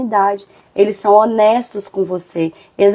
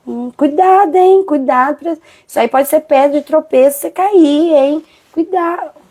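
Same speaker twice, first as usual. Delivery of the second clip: high, loud fake-concerned caution; mock false warning; 5 semitones higher, 3 dB louder than usual. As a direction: imitate a jealous friend pretending to worry. Falsa amiga alertando com inveja. (imitando amiga falsa com falsa preocupação, agudo e alto)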